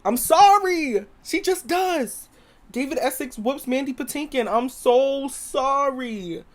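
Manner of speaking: sing-song fake apology; mock apology. Teasing Broadway fans with a drawn-out sorry.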